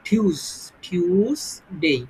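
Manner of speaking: থিউসডে as a slow "thyuus-dey"; long yu after a puffed t in থিউসডে; drawn-out correct Tuesday. Shown as a demonstration. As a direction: stretch থিউসডে slowly with aspirated t. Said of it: "তো এটার উচ্চারণ হয়ে যাবে".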